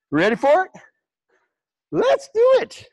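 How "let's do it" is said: sung out high and bright; hype call. Real, not acted. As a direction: pump up the class, pitch way up. Instructor hyping up the class.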